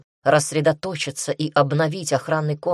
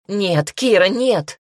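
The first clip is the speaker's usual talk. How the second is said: raised, louder cry of refusal; alarmed plea; 4 semitones higher, 6 dB louder, slower than usual. A narrator's reading. Mother stops her daughter leaving, in loud high alarm. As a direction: plead urgently, pitch climbing.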